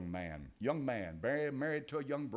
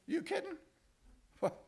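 mock disbelief. Preacher mocks the idea.

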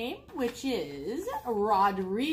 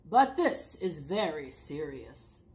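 low, slow, serious voice; solemn leader's pronouncement; grave chief frog; 7 semitones lower than usual. A narrator's reading. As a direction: weigh each word gravely. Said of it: "said the chief frog".

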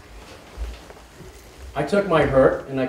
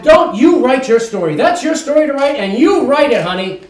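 mother, insistent; 10 semitones higher, 7 dB louder than usual. Retold or imitated. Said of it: "She said".

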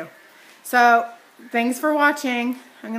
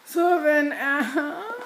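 pained wince; 5 semitones higher, much slower than usual. Real, not acted. Clothespin pinches face.

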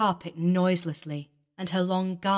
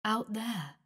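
hushed and distant; 3 semitones higher, 6 dB quieter than usual. Acted. Ghost names the cold place.

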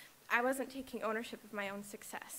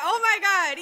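laughing; 8 semitones higher, 14 dB louder than usual. Real, not acted. Laugh-cry on graduation.

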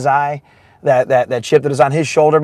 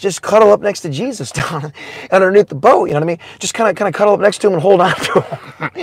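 ordinary delivery

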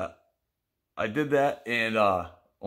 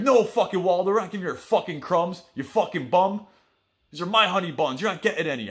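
much higher and louder, jeering inmate; mocking refusal; 8 semitones higher, 5 dB louder than usual. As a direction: bark it down at the beggar. Inmates refuse him honey bun crumbs.

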